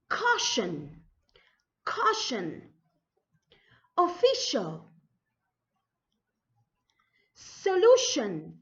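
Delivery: each word from "Caution" to "Politician" set apart, sh brought out; model pronunciation. Shown as a demonstration. Teacher modelling lesson three words, careful drawn-out enunciation. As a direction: enunciate "Solution", "Suspension" and the rest slowly, endings falling. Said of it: "see the correct pronunciation".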